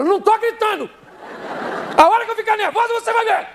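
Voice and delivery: very high-pitched hysterical yelling; shouting denial. Retold or imitated. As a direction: screech it like an outraged wife. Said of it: "essa praga tá gritando".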